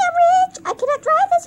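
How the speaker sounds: high, thrilled squeal; excited squeal. Real, not acted. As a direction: squeal with glee. Gloating over new car.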